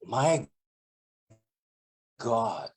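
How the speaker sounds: hammed-up horror at smelly shoes; disgusted demo. Shown as a demonstration. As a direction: let the smell hang in the pause. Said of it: "describing how smelly it is without saying it".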